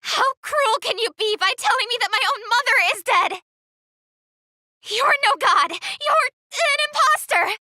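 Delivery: loud, outraged yelling at him; furious shouting. Acted. Adventurer shouts at the false god in outrage. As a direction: hurl every line at the so-called god.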